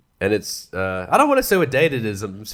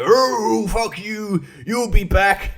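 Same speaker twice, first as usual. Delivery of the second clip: mock yell; 8 semitones higher, much slower than usual. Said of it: "standing there yelling".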